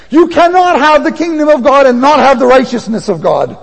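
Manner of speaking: loud, high proclamation building in force; full-force preaching. Real, not acted. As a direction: proclaim it like a warning from the pulpit.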